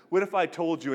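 imitating a big-shot speaker; boastful build-up. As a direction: throw it out like another big brag. Retold boasts piling up.

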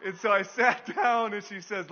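ordinary delivery

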